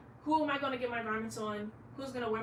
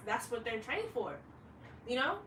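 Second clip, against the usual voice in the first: normal talking voice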